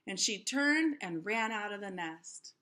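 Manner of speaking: ordinary delivery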